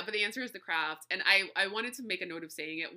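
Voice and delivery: ordinary talk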